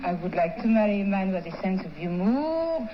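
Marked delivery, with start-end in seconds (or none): 2.0-2.9 "humor" with long rising French vowels; foghorn-like "humor". Real, not acted. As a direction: draw out "humor" like a ship's horn. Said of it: "That sounds like a ship going down".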